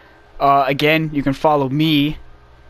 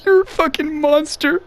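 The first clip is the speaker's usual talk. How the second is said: high-pitched mock crying; Tails; 12 semitones higher than usual. Crying Tails to Sonic on the ground, hammed-up high whimper. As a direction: squeak it out as a tearful accusation. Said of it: "Tails is just crying".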